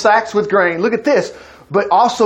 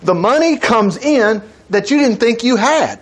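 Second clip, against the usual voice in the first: ordinary talk, nothing marked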